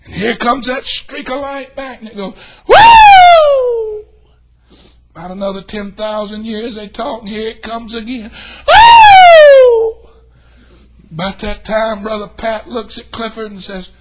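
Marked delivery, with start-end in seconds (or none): 2.7-4.1 joyful whoop. Acted. Streak of light shouting. 8.7-10.1 joyful whoop. Acted. Streak of light shouting again.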